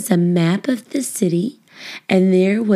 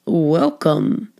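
lightly voiced fire chief, a touch lower; a hint of a friendly fire chief greeting the kids; fire chief's welcome; 3 semitones lower than usual. A narrator's reading. Fire chief welcoming the class, lightly voiced. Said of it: "he said".

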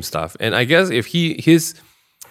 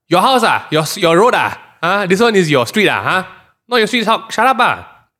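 rude passerby; 6 semitones higher than usual. Retold or imitated. A rude stranger taunting back, mock aggressive. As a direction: needle back like a cheeky stranger. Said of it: "That kind of thing".

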